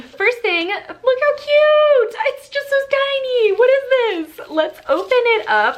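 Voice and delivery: high-pitched, drawn-out gushing over something cute; excited squeal. Real, not acted. Vlogger opening a tiny surprise item, squealing with excitement.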